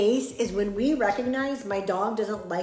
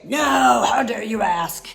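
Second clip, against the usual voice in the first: loud indignant snap; hammed-up offended shout; mock outrage; 7 dB louder than usual. Shown as a demonstration. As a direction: play the rude owner shouting back. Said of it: "You don't need to say".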